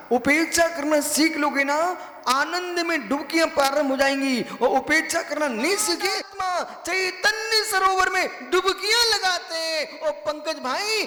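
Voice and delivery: high, sustained sermon delivery; impassioned preaching. Real, not acted.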